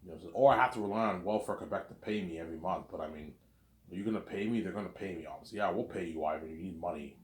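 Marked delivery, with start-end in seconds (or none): none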